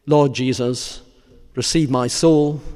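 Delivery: measured, fervent sermon close; solemn preaching. Real, not acted. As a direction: preach it weightily, phrase by phrase, letting each one fall. Preaches a closing meditation on Christ's death.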